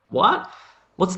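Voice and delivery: high-pitched, puzzled "what?"; baffled listener. Acted. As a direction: react as if the word made no sense.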